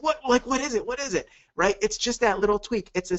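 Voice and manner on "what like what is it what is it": high, eager what is it; eager curiosity. Retold or imitated. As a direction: mimic the hooked prospect, pitched up. Imagined eager prospect asking.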